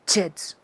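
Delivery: "Chets" opening on a combined t-ch; T-plus-CH demo. Shown as a demonstration. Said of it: "una t combinada con una ch".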